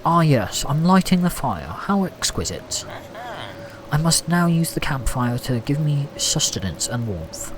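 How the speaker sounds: mock upper-class English accent; posh accent. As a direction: play it posh and exquisitely formal. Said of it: "act posh for a minute".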